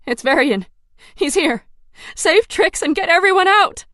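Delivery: frightened plea building from loud to a high yell; scared, urgent warning; trembling voice. Acted. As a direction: panic rising line by line. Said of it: "My voice trembles".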